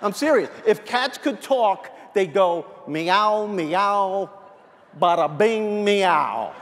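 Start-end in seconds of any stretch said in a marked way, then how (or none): 2.9-6.5 lightly voiced New York cat; New York attitude. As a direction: meow like a New York wise guy. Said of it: "have a New York attitude".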